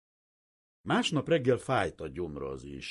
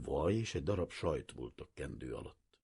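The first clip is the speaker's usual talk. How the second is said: ordinary delivery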